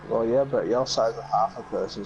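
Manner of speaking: ordinary talk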